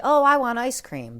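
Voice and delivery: put-on a kid spotting ice cream; eager craving. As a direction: blurt it like a sudden craving. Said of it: "that first thought says".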